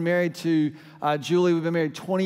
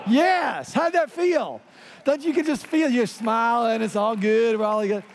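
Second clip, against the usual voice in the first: excited cheer; 4 semitones higher than usual. Real, not acted. Pastor cheering after the shout.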